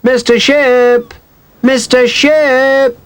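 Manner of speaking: high, slow, drawn-out call; a girl calling the neighbour from the yard, slow high call. A narrator's reading. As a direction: stretch each name to carry far. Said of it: "And Rosie called too".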